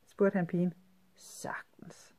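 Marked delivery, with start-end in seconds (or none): 1.1-2.1 sly conspiratorial whisper. Acted. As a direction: breathe it like a shared secret. Girl answers conspiratorially.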